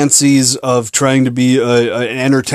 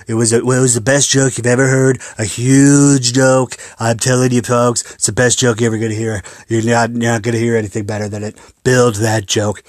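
Donald impression; 3 semitones lower than usual. Shown as a demonstration. Podcaster hyping his little joke, Donald impression. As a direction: drop the pitch and brag like a mock politician. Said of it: "sounds like Donald voice".

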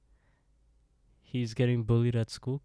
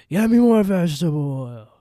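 higher, louder shrieking demand; furious yell; 7 semitones higher, 12 dB louder than usual. Retold or imitated. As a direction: scream the order at his sister. Drunk brother yells at sister.

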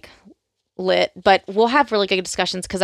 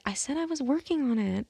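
hushed, higher quoted aside; small, raised-pitch imitation of a private remark; quiet aside; 5 semitones higher, 11 dB quieter than usual. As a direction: say it under your breath to the camera. Acted aside to the camera.

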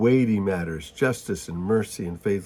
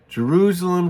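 a higher, louder cry of the city's name; anguished cry; 7 semitones higher, 4 dB louder than usual. Acted. Jesus crying out over the city he loves, a high, loud anguished call. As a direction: call out to Jerusalem in grief.

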